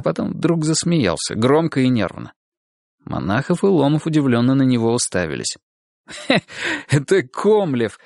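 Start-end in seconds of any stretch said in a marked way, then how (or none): 6.1-8.0 nervous laughing. A narrator's reading. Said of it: "отсмеявшись".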